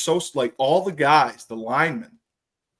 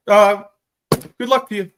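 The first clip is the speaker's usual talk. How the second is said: higher, louder, cheerfully dismissive send-off; breezy dismissal; 7 semitones higher, 7 dB louder than usual. Retold or imitated. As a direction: wave him off with mock brightness. Ed brushes off quitting teammate.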